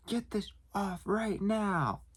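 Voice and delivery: very high, slow mock yell; imitated angry demand. Irate customer right up close to the clerk, mock-angry demand. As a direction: draw each word out in a held-back holler.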